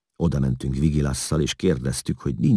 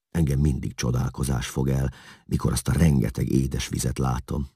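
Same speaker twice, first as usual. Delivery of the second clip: ordinary delivery, nothing marked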